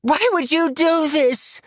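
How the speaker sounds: high, wailing accusation; crying out. Acted. Cindy burning, crying out to Quinn in a high wail. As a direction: wail it in betrayal. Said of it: "cried Cindy".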